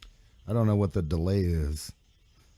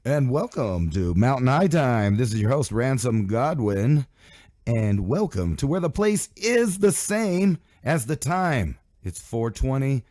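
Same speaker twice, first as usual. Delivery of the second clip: big, drawn-out announcer welcome; radio show intro; 6 semitones higher, 5 dB louder, slower than usual. Real, not acted. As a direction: open the show loud, high and slow.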